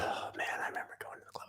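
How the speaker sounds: hushed, quick nostalgic whisper. Acted. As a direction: breathe it out like a secret memory.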